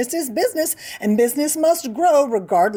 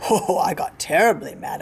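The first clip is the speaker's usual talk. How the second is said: plain reading voice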